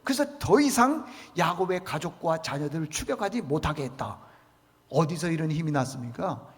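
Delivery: ordinary talk, nothing marked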